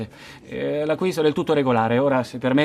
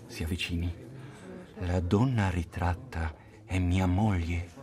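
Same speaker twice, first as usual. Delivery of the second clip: hushed confiding; 11 semitones lower, 10 dB quieter than usual. Acted. Confides a secret up close.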